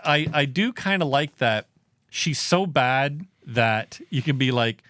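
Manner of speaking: usual speaking voice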